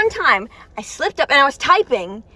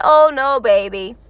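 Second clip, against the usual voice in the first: normal talking voice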